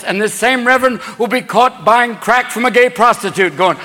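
normal talking voice

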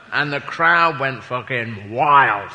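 ordinary talk

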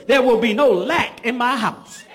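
congregation call, loud. Shown as a demonstration. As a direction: lead them in a loud declaration. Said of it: "say it loud".

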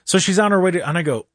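usual speaking voice

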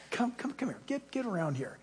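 very soft, coaxing beckoning; hushed father gathering the children close; fatherly melting. Acted. Peter gathering the scattered believers around him, tender and hushed. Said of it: "he just melts with his fatherly heart".

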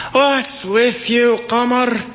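high, drawn-out call from afar; yelled question. A narrator's reading. Doorman calling up to a woman on a clothesline, long yell. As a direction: holler it up slowly, stretching the words. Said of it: "so he yelled at her".

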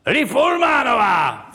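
high, drawn-out announcer's call; imitated ceremony announcer, stretching the name; shouted stage announcement. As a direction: bellow the guest's name to a hall. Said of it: "oni řvali".

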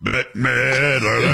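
Bette Midler stretched out very slowly. Shown as a demonstration. Host slowly spelling out [name] for the cohost. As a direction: drag out Bette Midler word by word. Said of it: "it almost sounded like you said, you were saying [name]".

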